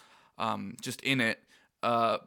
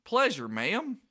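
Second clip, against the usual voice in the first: Goofy voice; 8 semitones higher, slower than usual. Retold or imitated. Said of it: "Goofy says".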